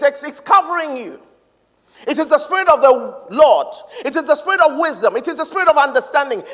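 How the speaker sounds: loud, high, rolling scripture declaration; full-force preaching. Real, not acted. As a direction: build a driving litany, phrase after phrase.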